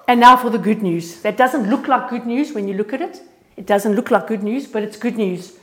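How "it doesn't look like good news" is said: ordinary talk, nothing marked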